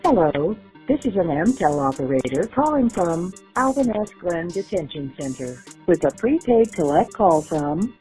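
recorded operator over a jail phone; automated prison phone prompt; payphone call. Real, not acted.

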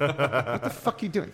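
high, loud, slow laughing wife; angry wife. Retold or imitated. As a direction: scold him like a fed-up wife through laughter. Wife scolding husband in bed.

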